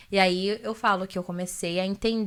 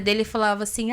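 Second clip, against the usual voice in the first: usual speaking voice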